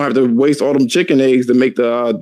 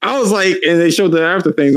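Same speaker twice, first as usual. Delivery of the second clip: ordinary talk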